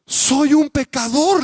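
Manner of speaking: high, loud, slow anguished cry; tearful shouted confession. Retold or imitated. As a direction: wail out your guilt like a scared boy. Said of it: "le dijo el niño".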